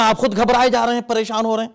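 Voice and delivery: ordinary talk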